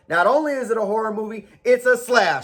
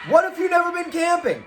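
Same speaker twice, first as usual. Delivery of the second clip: higher, fast shouted question; emphatic shout; 8 semitones higher, faster than usual. Shown as a demonstration. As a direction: fire the question out as a challenge.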